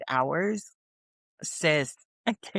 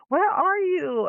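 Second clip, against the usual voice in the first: high, slow, louder mock tearful mom; 4 dB louder, slower than usual. Mom crying at the money she got, high and slow. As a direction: cry it out high as a moved mother. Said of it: "she started crying".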